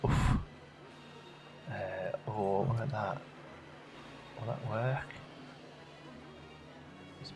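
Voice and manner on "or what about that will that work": usual speaking voice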